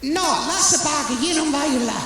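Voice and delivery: put-on high Portuguese-accented mother voice; mother insisting in a high accented voice; mother explaining where she shops, high and accented. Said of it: "mother answers".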